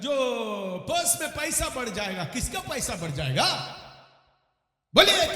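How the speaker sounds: loud, impassioned sermon delivery; full-force Hindi preacher; forceful preaching. Acted. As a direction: proclaim it to a congregation with punched key words. Preaches against prosperity preaching.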